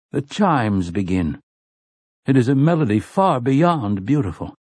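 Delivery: ordinary delivery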